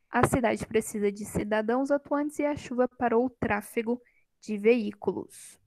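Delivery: ordinary talk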